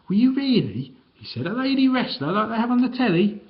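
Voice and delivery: high, eager astonishment; can't believe it, wide-eyed; Harry asks the wrestler, amazed; amazed boy. A narrator's reading.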